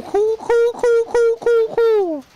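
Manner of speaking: high, panicked repeating of cool; losing it, voice jumping high; panicked squeak. Real, not acted. Panicking in horror game.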